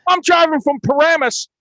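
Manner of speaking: loud, high-pitched, hammed-up Jersey loudmouth; Jersey guy accent. Imitating a Jersey crew guy griping about overtime, loud Jersey accent. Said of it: "Jersey, the whole fucking day, there's a guy going".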